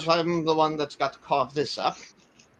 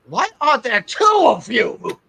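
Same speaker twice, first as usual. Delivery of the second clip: surprised shout; 10 semitones higher, 8 dB louder, slower than usual. Acted. Startled shout at player.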